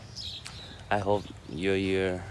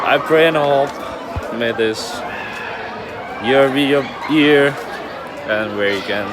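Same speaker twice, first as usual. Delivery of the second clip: loud, raised New Year greeting; shouted greeting; 6 semitones higher, 12 dB louder than usual. Real, not acted. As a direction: call out good wishes over the celebrations. New Year greeting amid noisy celebrations.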